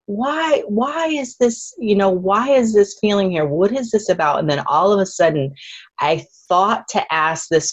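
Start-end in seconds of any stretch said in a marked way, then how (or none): 0.0-4.4 earnest plea, pitched up; pleading question. Retold or imitated. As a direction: ask it high and searching, half-crying. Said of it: "I'm like".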